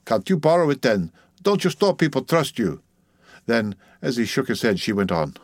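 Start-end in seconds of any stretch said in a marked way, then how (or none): 0.1-2.8 put-on higher-pitched Dutch-accented questioning; insistent Dutch-accented woman; Dutch midwife, pressing.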